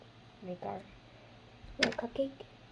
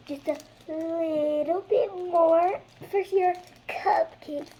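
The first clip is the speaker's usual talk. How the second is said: sing-song; 9 semitones higher, 9 dB louder, slower than usual. Acted. Sing-song while decorating.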